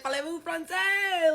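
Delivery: high, drawn-out French question; hammed-up French phrase; mock French show-off. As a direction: stretch the French out high and slow. Mock French retort in retold exchange.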